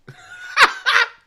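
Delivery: loud high-pitched cackle; shrieking laugh at his mate. Real, not acted. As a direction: burst out laughing loudly. Laughing at the voice note.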